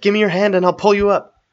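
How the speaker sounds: high, loud pleading order; urgent call. A narrator's reading. Arwen offers her hand to Strider, urgently calling. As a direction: urge them to grab hold, loudly.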